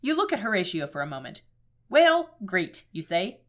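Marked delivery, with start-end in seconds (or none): 1.9-2.8 loud, higher Minnesotan; Minnesota accent. Acted. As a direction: chipper Fargo-style Minnesota accent. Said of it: "supposed to be doing a Minnesota voice".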